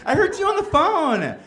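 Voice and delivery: high, sugary sweet wife voice; butter him up sweetly. Retold or imitated. Wife sweetly asking about his friends, high sugary voice. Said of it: "she's like".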